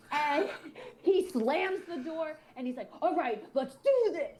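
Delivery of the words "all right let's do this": a mild mock wrestler pump-up; Hulk Hogan hype. Retelling the guy's hype, a light wrestler impression. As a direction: lightly ham up a pro wrestler psyching himself up. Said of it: "like Hulk Hogan or".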